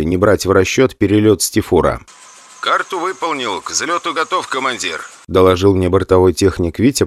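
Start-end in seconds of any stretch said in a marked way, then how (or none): 2.6-5.0 quieter report through the headset; calm crew report on intercom; over intercom. Acted. Flight engineer reports in cockpit. (доклад по внутренней связи, тише)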